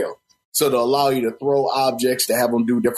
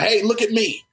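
high-pitched playful call; calling for attention; 10 semitones higher than usual. Acted. Player luring Pikachu for a picture, calling out high. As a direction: call out to get a creature to look.

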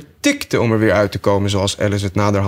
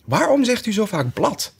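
put-on higher, peeved girl's question; somewhat miffed; 12 semitones higher than usual. Alice asks the sheep, somewhat miffed. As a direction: ask it slightly offended. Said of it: "enigszins gepikeerd".

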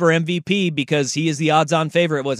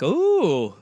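higher, excited "ooh" of discovery; playful raised-pitch wife voice; imitating his wife; 6 semitones higher, much slower than usual. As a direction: mimic a wife eagerly spotting phone news. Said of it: "she'll be like".

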